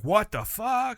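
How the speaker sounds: high-pitched mock disbelief; baffled viewer. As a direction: blurt it as a stunned movie fan. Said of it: "and you're like".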